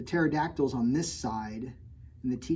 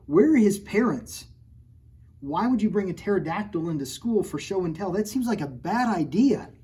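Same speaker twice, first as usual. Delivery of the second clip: higher and louder, exasperated; mock outrage; 4 semitones higher, 6 dB louder than usual. Real, not acted. Teacher hamming up outrage about the pterodactyl. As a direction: rant about the silly book.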